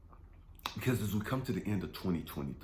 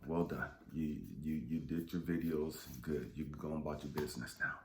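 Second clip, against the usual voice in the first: voice of God; 7 semitones lower, faster than usual. Retold or imitated. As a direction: lightly voice God as calm and dismissive. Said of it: "I wanted God to say".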